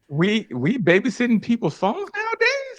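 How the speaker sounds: high, mocking "who does that"; incredulous mocking. Real, not acted. Scoffing at her friend's-phone story, high incredulous voice. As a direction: pitch up in disbelief at the excuse.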